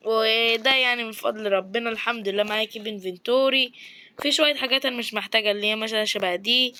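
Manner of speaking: usual speaking voice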